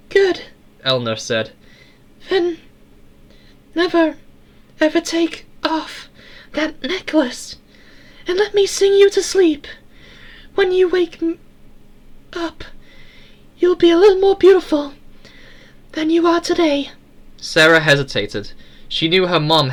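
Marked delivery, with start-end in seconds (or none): 0.1-0.4 very high tinny falsetto; kindly little metallic girl voice, pitched way up; Eleanor, metallic but kind. A narrator's reading. Eleanor thanking Sarah for saving her, kind tinny voice. 2.3-16.9 tiny, very high falsetto; tinny little doll-like voice, pitched way up; Eleanor girl voice. A narrator's reading. Eleanor sending Sarah to sleep, tinny little voice.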